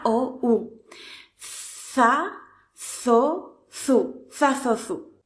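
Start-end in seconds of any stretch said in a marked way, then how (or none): 1.4-5.2 za, zo, zu with a lisped th; th syllables. Shown as a demonstration. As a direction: bite the tongue for za, zo, zu. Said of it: "Para la Z". (za, zo, zu con zeta castellana)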